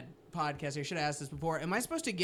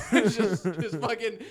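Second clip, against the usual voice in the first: ordinary talk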